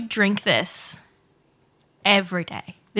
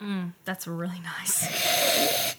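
slow, hushed whisper after a sip; savouring whisper; 3 semitones lower, 9 dB quieter, much slower than usual. Real, not acted. Friend tasting bourbon whispers her delight, slow and hushed. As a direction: breathe the words out, savouring the taste.

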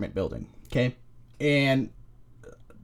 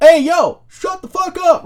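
high, loud mock yell out the window; yelling up at noisy neighbors, half-joking; retold yell; 13 semitones higher, 7 dB louder, slower than usual. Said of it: "yelled up".

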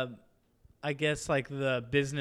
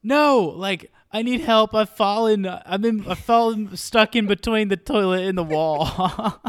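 higher and louder, half laughing, imitating a stuck Michael; hammed-up cry for help, breaking into laughter; mock panicked yelling; 7 semitones higher, 4 dB louder than usual. Michael stuck by the toilet yells for help, a laughing mock panic. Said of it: "constantly yelling for Ryan".

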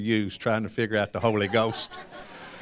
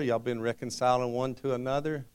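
ordinary talk, nothing marked

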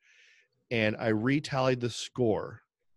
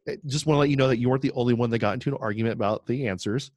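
ordinary talk

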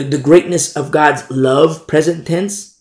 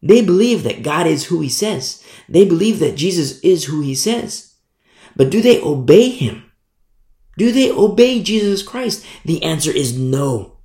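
normal talking voice

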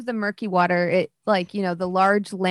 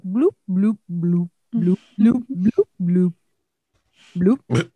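bubbly bloop noises; 5 dB louder, slower than usual. Acted. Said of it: "you would probably hear".

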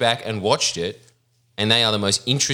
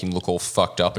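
usual speaking voice

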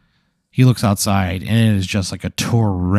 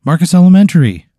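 called through door; 8 semitones higher, 8 dB louder than usual. Acted. GM voices Marcus at door.

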